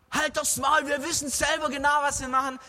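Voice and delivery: a touch of a high irritated shout; mock angry yell. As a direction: snap back at the know-it-all. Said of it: "wollte der auch wieder hinschreien".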